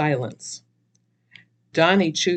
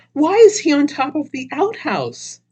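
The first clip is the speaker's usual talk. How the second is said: shocked; 11 semitones higher, 6 dB louder than usual. Acted. Shocked question to Donnie.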